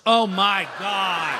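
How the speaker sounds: loud, drawn-out mock disbelief; shocked outcry. Dancer hearing they made the finals, stunned outcry. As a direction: cry out slowly at the dreadful news. Reacting to surprise news of finals.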